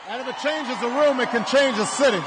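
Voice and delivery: high, loud preaching shout; a candidate firing up a rally crowd; rally speech. Acted. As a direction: rouse the crowd at full force.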